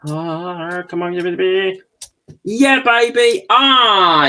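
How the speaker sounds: excited relieved whoop; triumphant cheer. Real, not acted. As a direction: celebrate the part finally going in. Part finally clicks into place.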